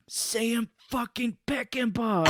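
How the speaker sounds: high, slow stretched mock shout; slow, wailing impression; drawn-out Cage yell. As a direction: draw out every word like a madman. Imitating Cage's shouted answer.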